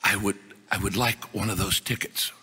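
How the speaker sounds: a humble Chinese professor asking in careful broken English. Retold or imitated. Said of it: "says to me in broken English".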